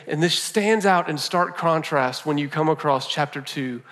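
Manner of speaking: normal talking voice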